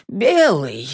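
a touch of woe in the goose's answer; sorrowful sigh. A narrator's reading. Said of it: "горестно вздохнув". (с горестным вздохом)

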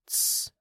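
soft, short voiceless "shh" hiss; quick hushed "tss" to silence someone; shushing. A narrator's reading. Boy stopping friend from spilling secret, shushing.

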